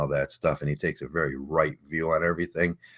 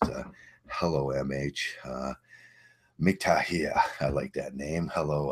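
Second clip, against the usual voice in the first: ordinary delivery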